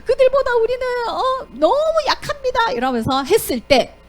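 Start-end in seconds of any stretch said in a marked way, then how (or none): 0.1-2.7 high crying plea, slowing at the end; whining sob of a frightened crowd; despairing wail. Acted. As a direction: wail like people begging to die.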